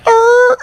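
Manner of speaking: loud, high imitated "arr" of shock; high, blaring mock crowd reaction; crowd's aghast noise. Comic imitating a horrified club crowd, loud high cry. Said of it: "the whole crowd just came to".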